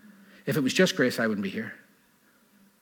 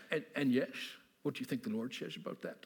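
lightly put-on Dutch accent, soft; quiet pastor in a Dutch accent; 2 semitones higher, 10 dB quieter than usual. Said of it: "stop with the Dutch accent".

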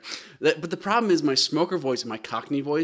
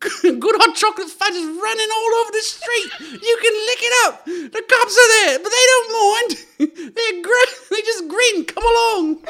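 very high, loud squeaky urchin; little scamp; 8 dB louder than usual. Acted. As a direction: squeal it through giggles like a waif. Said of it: "can I hear it as a scamp".

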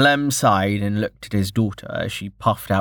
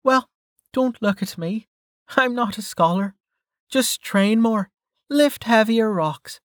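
higher-pitched friend, brushing off a question; casual friend's voice; 12 semitones higher than usual. Acted. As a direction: voice Mel, pitched up from the narration.

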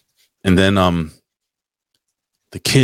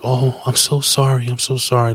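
higher-pitched retold apology; apologetic; 6 semitones higher than usual. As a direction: imitate someone pleading sorry, pitched up. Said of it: "was so apologetic".

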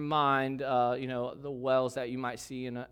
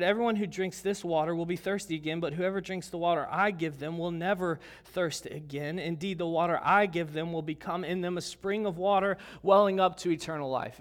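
scripture reading; 4 semitones higher, 5 dB louder than usual. Acted. Reading scripture aloud to congregation.

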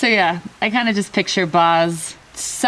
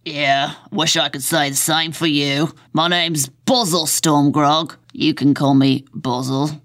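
grumpy Boz; 3 semitones lower than usual. Acted. Boz introduces himself to Tina, grumpy and unimpressed. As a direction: sound put out, in a thick put-on voice.

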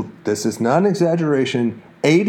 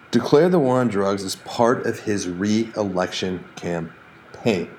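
ordinary talk, nothing marked

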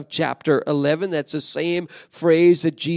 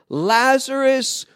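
high, loud command calling into the tomb; a loud shout of command at the tomb; emphatic loud call; 7 semitones higher, 4 dB louder, slower than usual. Retold or imitated. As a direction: call him out with full force. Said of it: "he says emphatically in a loud voice".

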